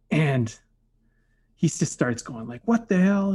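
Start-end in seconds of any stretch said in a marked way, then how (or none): 2.7-3.3 higher, mock angry boss blurt; angry outburst. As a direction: snap like an annoyed boss catching a mess. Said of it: "he starts berating me".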